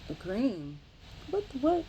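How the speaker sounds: high, loud disbelief; wife stunned by a cream as a gift; incredulous. Retold or imitated. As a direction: squeal it in shock. Wife baffled by odd gift.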